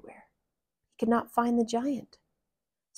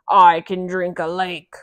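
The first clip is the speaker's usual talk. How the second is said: giant voice; 2 semitones lower, 9 dB louder than usual. Acted. Giant boasts.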